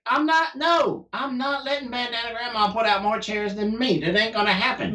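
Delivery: high-pitched, emphatic retold protest; defiant refusal. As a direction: act out refusing to be outdone. Retold competitive vow during chair race.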